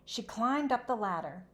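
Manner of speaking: usual speaking voice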